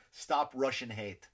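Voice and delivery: normal talking voice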